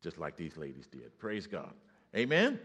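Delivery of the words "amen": loud, high rising call; call for amen. Real, not acted. Preacher calling the church to answer. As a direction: lift it up to draw a response. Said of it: "calls for response".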